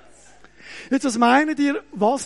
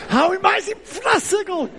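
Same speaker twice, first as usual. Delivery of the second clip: much higher and louder, laughing; a gleeful vengeful plea to God; laughing mock rage prayer; 10 semitones higher, 4 dB louder than usual. Simon's prayer, acted with laughter. (lachend gespieltes Rachegebet, viel höher)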